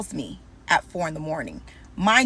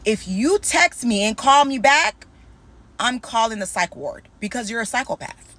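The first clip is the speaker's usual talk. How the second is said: ordinary talk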